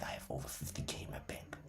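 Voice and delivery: fast whispered boast; quick whisper. Retold or imitated. Trader telling a colleague his balance, fast whisper. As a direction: let the secret slip out in a rushed whisper.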